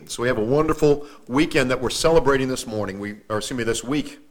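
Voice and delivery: ordinary talk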